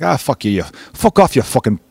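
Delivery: badly faked Irish brogue; bad Irish accent. Irish bar regulars rebuffing the American, bad Irish accent. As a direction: put on a clumsy Irish accent. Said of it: "I just did a bad accent right there".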